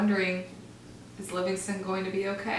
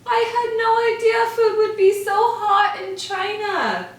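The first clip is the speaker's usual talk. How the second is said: pained squeak; 12 semitones higher, 8 dB louder than usual. Acted. Mouse with burning tongue.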